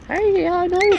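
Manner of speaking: high excited answer; high squeal. Real, not acted. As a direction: mimic the squeaky bird. Answering squawking bird.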